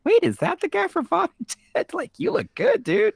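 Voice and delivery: high, excited Wait, is that the guy; surprised recognition. Retold or imitated. Viewer spotting the Father Ted actor, high surprised gush. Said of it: "I was like".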